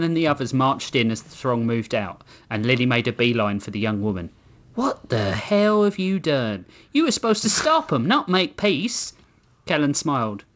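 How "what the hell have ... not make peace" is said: high-pitched acted indignation; indignant. A narrator's reading. Lily confronting the young woman about making peace, indignant. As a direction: drag out the opening, then scold higher.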